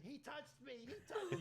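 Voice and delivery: put-on high shrill kid wailing; kid's false accusation. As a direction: yell it like a lying kid.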